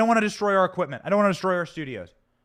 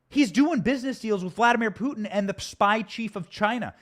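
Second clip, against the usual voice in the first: ordinary talk, nothing marked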